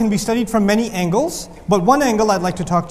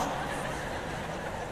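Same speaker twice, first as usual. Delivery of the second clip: breathy chuckle; a quiet laugh; soft laugh; 18 dB quieter than usual. Real, not acted. Laughing at his own story.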